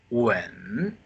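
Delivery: long "wen" dipping low, then rising; drawn-out dip-and-rise "wen"; third tone. Shown as a demonstration. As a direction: drop "wen" down and slowly bring it back up. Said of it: "the third tone is".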